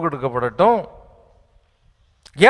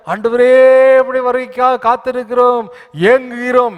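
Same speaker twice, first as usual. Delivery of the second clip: raised pitch, loud mock pleading; mock longing song; 6 semitones higher, 5 dB louder than usual. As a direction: parody the eager believer's cry. (ஏங்கும் விசுவாசியின் கேலியான உரத்த குரல்)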